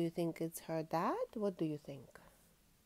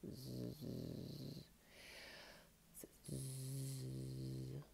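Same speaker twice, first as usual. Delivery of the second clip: low, soft drawn-out snore; snoring; 8 semitones lower, 11 dB quieter than usual. Acted. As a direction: snore like a sleeping bear. Dad bear snoring in lair.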